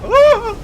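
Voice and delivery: loud high mock yelp of panic; retold yelp. Player replaying their panicked yelp at the fall, loud and high. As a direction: shout Ah! as if falling again. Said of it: "is I go".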